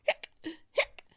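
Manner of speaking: sharp loud hics; abrupt hiccup bursts. Acted. Boy hiccuping after his complaint, sharp hiccups.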